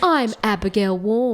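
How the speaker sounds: slow Australian anchor sign-on; Australian newsreader introducing herself; Australian newscaster. Acted. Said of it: "Australian newscaster voices".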